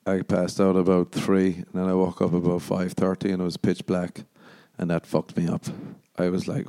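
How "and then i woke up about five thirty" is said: usual speaking voice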